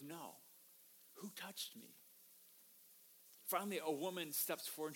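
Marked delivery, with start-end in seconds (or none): 0.0-1.9 very soft and unhurried; quiet firmness. Acted. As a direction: refuse softly, then ask gently. Jesus quietly stands firm against urging.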